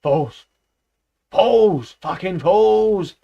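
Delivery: loud, slow, raging cries; furious shout. A narrator's reading. Benjamin stamping round the naive animals, furious shout. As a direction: spit out each fools in fury. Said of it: "shouted Benjamin".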